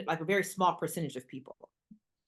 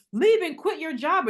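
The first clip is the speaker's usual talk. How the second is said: high, loud pushy urging; mock pep-talk shout; urging push; 9 semitones higher, 7 dB louder than usual. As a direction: egg them on to leap. Hardcore cliff-jumper urging you.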